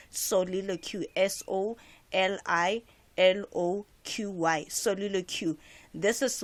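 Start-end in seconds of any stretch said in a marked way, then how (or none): 1.2-4.7 slow, letter-by-letter spelling; spelled out. Shown as a demonstration. Teacher spelling a literature term for learners, spelled out slowly.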